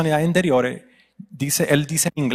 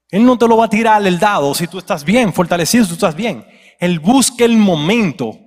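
loud, raised preaching pitch; forceful preaching; 6 semitones higher, 7 dB louder than usual. Real, not acted. Preacher warning the church the enemy seeks weakness, forceful preaching. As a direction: press the warning on the congregation, loud and high.

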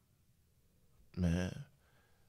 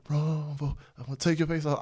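higher-pitched mock rap hype; imitating son; 7 semitones higher than usual. Son reciting Rumble lyrics to himself, higher-pitched imitation. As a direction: lightly mimic a kid chanting along. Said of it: "So he's like".

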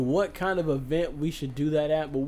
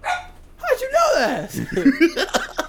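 high, slow, laughing disbelief; laughing amazement; 6 dB louder, much slower than usual. Real, not acted. Friend laughing in disbelief at a gun guess, high and drawn out. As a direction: stretch it out through real laughter.

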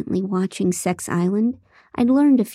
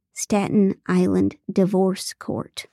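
plain reading voice

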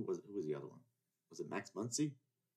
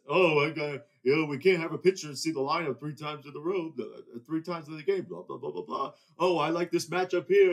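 louder mock manager voice, dismissive; 4 semitones higher, 5 dB louder than usual. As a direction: parody the number crunchers. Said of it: "It's just".

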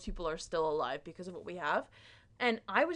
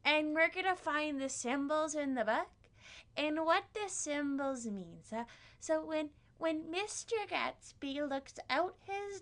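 English teacher impression; 6 semitones higher than usual. Teacher explains the book's symbols, high mock-teacher voice. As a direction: lecture in a put-on, over-earnest voice. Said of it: "This is indeed my impression of my, um, eleventh grade English teacher".

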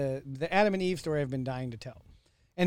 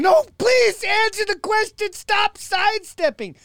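slow, much higher, louder shouted plea; yelling at phone; 8 dB louder, slower than usual. Retold or imitated. Listener yelling at his phone at an evasive guest, loud slow shout. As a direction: yell slowly at the screen, demanding an answer. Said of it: "start yelling at my phone".

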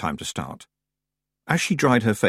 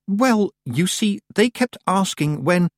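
put-on hesitant girl; 10 semitones higher, slower than usual. A narrator's reading. Tiffany hesitates.

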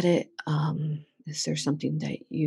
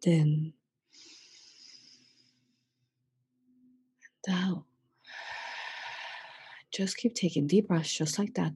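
soft meditation voice with long pauses; hushed, spacious breathing cues; guided breathing; 6 dB quieter than usual. Real, not acted. Leads breathing exercise.

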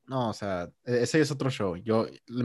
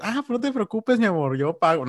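put-on eager boyfriend; 10 semitones higher than usual. Acted reply of paying boyfriend.

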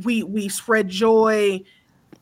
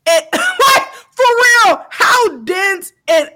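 high, loud cry of outrage; slowing cry of real outrage; 11 semitones higher, 7 dB louder, slower than usual. Real, not acted. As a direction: voice breaking into a shout. Ranting, voice cracks with emotion.

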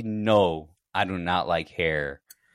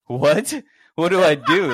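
higher, louder bewildered retelling; acted-out burst of alarm; startled reaction; 8 semitones higher, 7 dB louder than usual. Friend retelling a dog jumping into the car, startled.

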